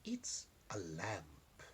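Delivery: slow secret whisper; whispered, slowly revealing the lamp. Acted. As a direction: breathe it out like a secret. Old lady knows secret.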